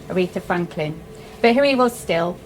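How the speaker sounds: usual speaking voice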